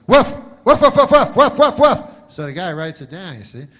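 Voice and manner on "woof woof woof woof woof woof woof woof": shouted woofs up high like a yapping dog; loud high barking. Retold or imitated. Dog barking its message to the telegram clerk, loud and high. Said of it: "So the dog goes".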